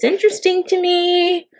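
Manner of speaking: sarcastic. Acted.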